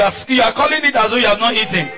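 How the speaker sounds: ordinary talk, nothing marked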